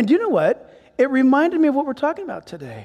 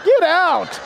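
mock outburst; 6 semitones higher than usual. Window-seat lover refusing the shade request, mock indignant.

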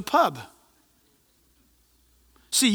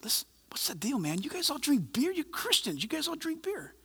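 much softer, leaning in to ask quietly; half-whispered question to the next table; retold hushed aside; 3 semitones higher, 10 dB quieter than usual. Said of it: "I leaned over and I said".

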